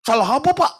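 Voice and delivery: high, sharp indignant challenge; glaring wife's retort. Retold or imitated. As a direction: fire back glaring. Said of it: "sekarang melototin saya". (istri melotot menantang, nada tinggi)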